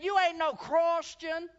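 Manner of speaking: high, loud, slow scornful yell; hammed-up accusing shout; mock angry shout. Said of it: "And so she said".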